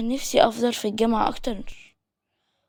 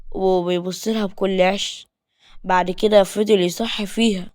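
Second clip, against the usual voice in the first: ordinary delivery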